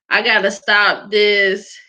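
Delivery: usual speaking voice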